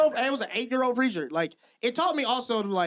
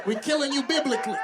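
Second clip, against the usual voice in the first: higher-pitched emphatic verdict; emphatic punchline; 6 semitones higher than usual. Real, not acted. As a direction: declare it high and firm to the crowd. Punchline delivered out to the crowd.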